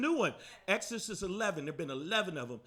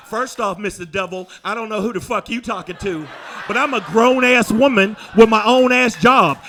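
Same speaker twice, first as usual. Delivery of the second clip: sassy woman voice; 13 dB louder than usual. Retold or imitated.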